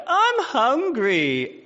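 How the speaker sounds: high, very slow, drawn-out whine; whiny hungry child. Retold or imitated. A parent imitating his kids whining for food. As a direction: drag it out like a whining kid. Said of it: "No, they sound like".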